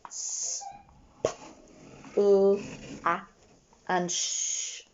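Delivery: each sound said apart, "S" and "sh" hissed; sound buttons. Shown as a demonstration. Teacher sounding out splash for the class, sound by sound. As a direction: tap out each sound slowly. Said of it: "Let's do our sound buttons quickly".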